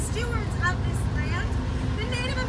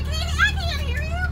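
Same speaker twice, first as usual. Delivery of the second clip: put-on Southern accent; 8 semitones higher than usual. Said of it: "I don't know why I'm Southern all of a sudden".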